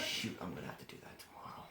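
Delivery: fast low under-the-breath mutter; quick breathy gorilla aside. Acted. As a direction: grumble it quickly to himself. Gorilla's inner thoughts voiced.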